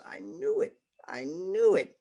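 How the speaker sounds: God crowing in delight; gleeful God. Acted. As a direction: burst out like a proud father. God's gleeful reaction.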